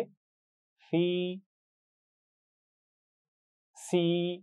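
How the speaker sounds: सी held long with a whistling s; long whistled ee. Shown as a demonstration. Teacher contrasts two s letters for the class: drawn-out सी. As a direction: stretch सी and let the s whistle. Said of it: "इसमें सीटी होनी चाहिए".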